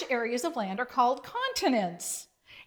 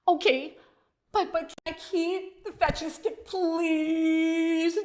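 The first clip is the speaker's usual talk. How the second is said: higher, begging pup voice; pleading; 5 semitones higher than usual. A narrator's reading. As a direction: wheedle for the stick. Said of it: "Duke pleaded".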